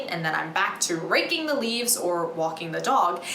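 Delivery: ordinary delivery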